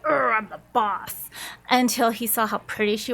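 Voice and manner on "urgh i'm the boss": lightly mocked blustering boss, opening on a growled grunt; big boss act. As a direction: imitate a self-important boss, half in play. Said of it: "coming in like this big".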